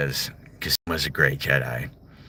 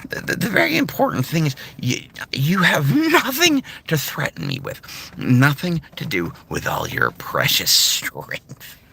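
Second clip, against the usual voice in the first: higher, menacing taunt; hint of the Joker; 10 semitones higher than usual. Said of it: "you got the Joker".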